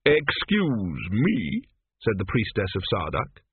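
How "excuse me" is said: very slow, drawn-out objection; pointed interruption. A narrator's reading. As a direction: stretch it out, affronted. Priestess objects to being left out.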